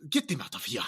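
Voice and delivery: stammered banishing in a put-on African accent; hammed-up African-accented dismissal; mock African accent. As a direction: order them off in a stock African accent. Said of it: "They're gonna be like".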